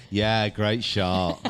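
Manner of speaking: slow, drawn-out mock praise; sarcastic great shot. Shown as a demonstration. Showing the toxic tone of praise, slow and sarcastic. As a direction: drag it out sarcastically. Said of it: "if you say it in that tone".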